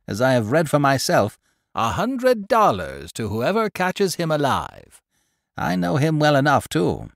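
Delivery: chattering market woman spreading news; gossipy old woman. Acted. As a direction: relish every grisly detail like a village gossip. Pundor on the manhunt.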